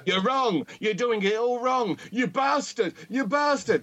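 high, shrill mock ranting; nagging shouts. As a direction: scold like a pest over the shoulder. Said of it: "sitting on their shoulder saying".

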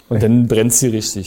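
usual speaking voice

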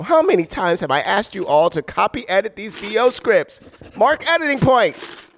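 fed-up voice actor rebuking the crew; exasperated outburst. Real, not acted. Voice actor scolds staff over typo.

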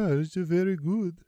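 high, slow sing-song Nordic voice; Nordic accent. Retold or imitated. Nordic accent, friend tries a Norway voice. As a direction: Nordic lilt, drawn out. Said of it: "like a Nordic accent".

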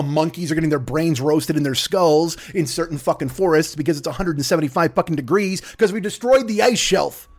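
ordinary delivery

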